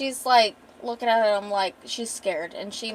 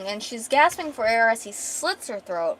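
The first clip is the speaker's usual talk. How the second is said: ordinary talk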